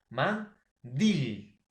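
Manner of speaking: "mandil" split in two, loud stretched "dil"; slow "man... dil", punching the final syllable; stress on last syllable. Shown as a demonstration. Teacher showing students an aguda word, last syllable stressed in "mandil". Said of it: "la mayor fuerza de voz en la última sílaba".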